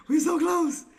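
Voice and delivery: very high, whiny squeal; high-pitched lament. Real, not acted. Trader bemoaning the near miss, high-pitched whine. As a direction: wail it way up high. Repeats lament far higher.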